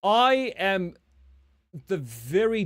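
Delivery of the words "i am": usual speaking voice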